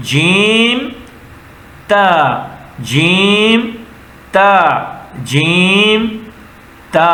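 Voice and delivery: each letter drawn out with pauses, rising on جیم; teacher modelling syllables with long pauses; slow letter-by-letter reading. Shown as a demonstration. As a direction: sound out جیم and تا slowly, one at a time. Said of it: "اب دونوں کو ملائیے".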